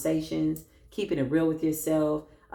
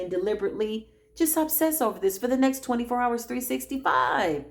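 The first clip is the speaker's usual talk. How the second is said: usual speaking voice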